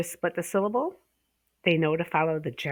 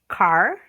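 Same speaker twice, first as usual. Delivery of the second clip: one loud clapped beat on "car"; clapped syllable; 2 semitones higher, 8 dB louder than usual. Shown as a demonstration. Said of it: "So you're gonna wanna clap".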